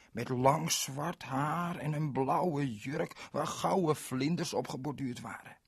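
plain reading voice

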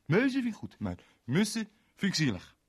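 high, loud whining; upset friend begging; tearful plea; pleading. Acted. Anton pleading.

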